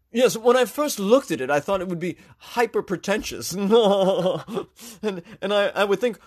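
high, loud, giggly pretentious voice; high-pitched snooty coffee snob giggling between lines; pretentious San Francisco snob. Acted. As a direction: ham up a smug rich hipster gushing through laughs. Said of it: "That's how he talks".

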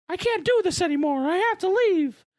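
high, loud Mickey voice; Mickey Mouse voice. Retold or imitated. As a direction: squeak it out like Mickey. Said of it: "Mickey is like".

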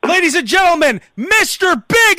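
high, grand intro with a stretched band name; show announcer. Retold or imitated. Host re-enacting his botched intro, announcer voice. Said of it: "I would fuck up the intro".

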